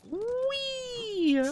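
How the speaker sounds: high, gleeful squeal. Real, not acted. Playful cheer on head pats.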